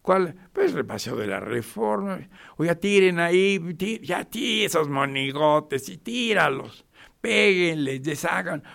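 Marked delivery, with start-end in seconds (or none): 2.6-8.6 higher, louder rowdy crowd egging others on; acted rowdy shouting. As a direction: shout like a vandal urging the mob. Acts out vandals urging destruction.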